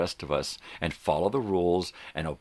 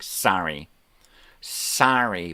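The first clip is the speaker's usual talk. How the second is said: mock sorries; 3 dB louder, much slower than usual. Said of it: "turned into the next aisle saying".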